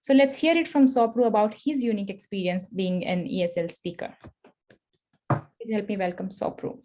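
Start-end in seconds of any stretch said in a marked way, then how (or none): none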